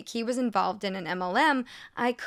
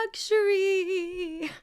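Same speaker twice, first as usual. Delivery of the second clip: drawn out slow, pitched high and mocking; a slow, high sarcastic drawl of Luxury; 9 semitones higher, much slower than usual. Real, not acted. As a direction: stretch the word out with dripping scorn.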